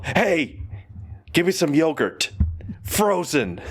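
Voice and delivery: put-on demanding teen daughter. Imagined daughter at prom ordering yogurt, lightly bossy. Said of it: "They're at prom".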